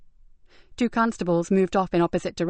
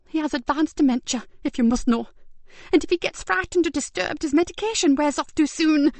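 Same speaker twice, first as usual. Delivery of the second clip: high, tense woman's voice; justifying herself anxiously; defensive carer; 8 semitones higher than usual. Acted. Carer explaining the old man's illness, anxious. Janet's character voice.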